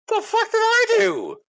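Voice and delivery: very high, drawn-out squawk of protest; mad, indignant. Retold or imitated. Husband protesting after his wife yells at him, mad and indignant. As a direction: protest your innocence in a squealing high voice. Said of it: "I was so mad".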